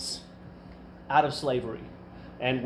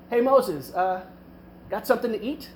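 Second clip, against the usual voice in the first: higher-pitched, tentative casual ask; casual polite request; 5 semitones higher than usual. Retold or imitated. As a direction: imitate a mild, no-fuss request. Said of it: "probably not like".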